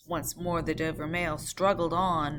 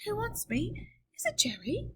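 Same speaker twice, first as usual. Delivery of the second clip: much higher, a touch of a quaver; mildly quavering. Acted. Mr Lorry asking who wants him, mildly quavering. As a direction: ask nervously into the dark. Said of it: "with mildly quavering speech".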